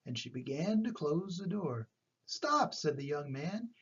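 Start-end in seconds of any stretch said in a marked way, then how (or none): 2.3-2.7 high sharp call of stop; urgent call. Acted. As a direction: stop her before the door shuts. Young man stops the door.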